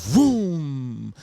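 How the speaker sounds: high, drawn-out vroom; imitated car take-off. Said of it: "the car drives off".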